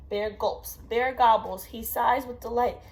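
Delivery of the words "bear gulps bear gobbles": usual speaking voice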